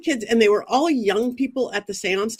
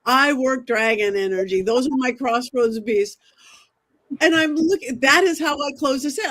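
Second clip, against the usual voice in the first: normal talking voice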